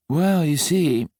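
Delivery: slow, weary sigh of a line. A narrator's reading. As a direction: let it out on a tired sigh, slowly. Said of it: "sighed Patrick".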